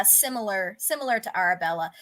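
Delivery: ordinary delivery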